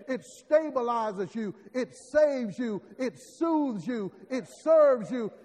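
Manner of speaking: rising, loud preacher cadence; fiery sermon build; preaching. Acted. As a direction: preach it with full force.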